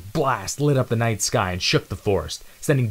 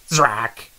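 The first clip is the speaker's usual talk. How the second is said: louder sharp zap effect; blaster zap; 4 semitones higher, 6 dB louder than usual. Acted. Narrator voicing the shot that hits Zero, zap. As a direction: spit out the laser hit.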